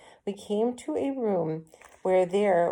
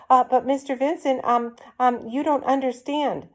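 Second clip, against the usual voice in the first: flustered calling; 8 semitones higher, 4 dB louder than usual. A narrator's reading. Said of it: "She called".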